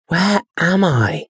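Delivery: put-on boy lost in a strange forest; fearful awe. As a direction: whisper of fear and wonder. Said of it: "with a mixture of fear and awe".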